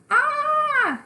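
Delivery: piercing high scream; child's high scream. Acted. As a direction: shriek in panic. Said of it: "hears my scream".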